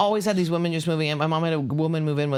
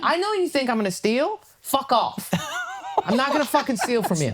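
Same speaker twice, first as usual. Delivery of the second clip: mock high snap; 9 semitones higher than usual. Mock snapping at a clerk.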